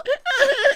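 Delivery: very high fake sob; squealed imitation of a brat's crying; mock whiny crying. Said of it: "he's this whiny little".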